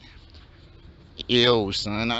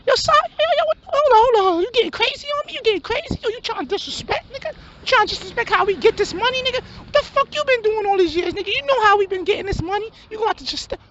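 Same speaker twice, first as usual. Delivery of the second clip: hustler friend; 7 semitones higher than usual. Acted. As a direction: shoot it up high in disbelief. High-voiced hustler gets defensive.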